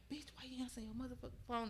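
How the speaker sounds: fast, whispered imitation of the attacker; retold attacker whisper. Retelling the attacker confronting her about the phone, whispered. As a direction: whisper his angry question as you retell it. Acting out attacker's words, unvoiced.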